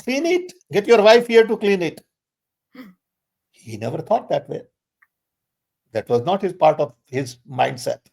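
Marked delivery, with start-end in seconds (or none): 0.0-2.0 high, raised, exasperated rebuke; retold indignant scolding. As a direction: tell him off, incredulous and sharp. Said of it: "Okay, I said".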